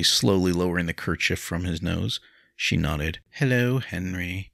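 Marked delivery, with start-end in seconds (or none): 3.3-4.5 small, slow, mousy greeting. A narrator's reading. Woman greeting Henry at her door, mousy. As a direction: draw it out timidly, like a mouse. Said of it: "she said in a mousy voice".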